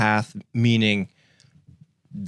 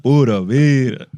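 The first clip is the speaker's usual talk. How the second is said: shouted greeting; 3 semitones higher, 9 dB louder than usual. Acted. Guest's entrance catchphrase.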